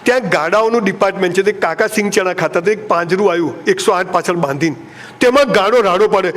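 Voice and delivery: ordinary talk, nothing marked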